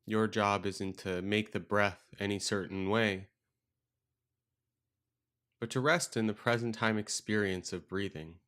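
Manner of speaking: calm meditation guide with long, restful pauses; guided meditation. Acted. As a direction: guide them gently into stillness, leaving space between phrases.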